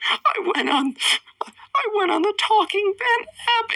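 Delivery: very high, squeaky put-on crying-kid voice; tearful boy. Boy confesses to the girl who caught him, mock tearful. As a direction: wail it as a hammed-up tearful child. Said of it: "full of tears".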